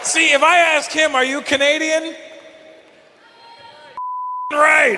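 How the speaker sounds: loud, high holler; high-pitched yelling for laughs; comic shouting. Pretend. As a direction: bellow it to the whole room. Shouting bit about audience member.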